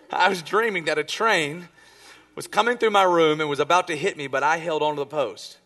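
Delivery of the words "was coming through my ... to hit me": ordinary delivery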